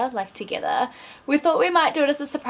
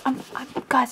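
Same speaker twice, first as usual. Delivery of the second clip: usual speaking voice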